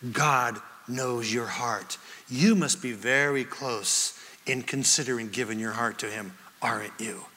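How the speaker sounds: low, soft, tender retelling; hushed, caring evangelist; gentle appeal. Retold or imitated. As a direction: lean in quietly and coax him. John gently urging the bartender to believe.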